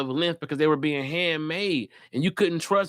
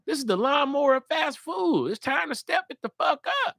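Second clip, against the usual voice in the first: ordinary talk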